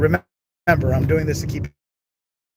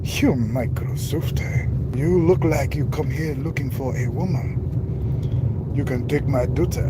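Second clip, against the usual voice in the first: slow, put-on Chinese accent; hammed-up mock Chinese accent, words drawn out; slower than usual. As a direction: imitate a Chinese accent, slowly. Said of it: "I could do a Chinese accent".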